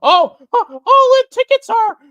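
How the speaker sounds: high, loud squealing impression of an excited announcer; mock him with a shrill, giddy squeal; squeaky mock Tony Khan. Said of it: "him going".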